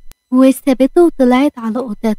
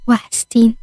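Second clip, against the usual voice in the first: ordinary delivery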